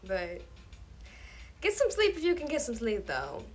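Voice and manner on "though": usual speaking voice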